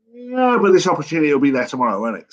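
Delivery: high, fast, loud pushback; mock-exasperated phone protest; exasperated retort. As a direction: fire it back, sharp and quick. Arguing with pushy caller on phone.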